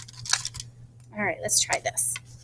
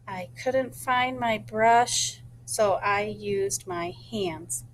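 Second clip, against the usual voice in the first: slow rehearsing; 3 dB quieter, slower than usual. Shown as a demonstration. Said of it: "I think I like that better".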